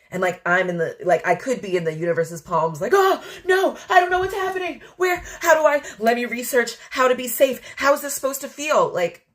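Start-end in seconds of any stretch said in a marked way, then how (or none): 2.9-8.9 flail with worry; imitating herself panicking on the journey; mock panic. Panicking in the universe's palms.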